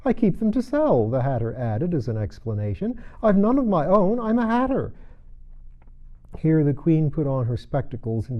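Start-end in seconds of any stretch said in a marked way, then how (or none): 0.0-4.9 Hatter voice. Acted. Hatter explains.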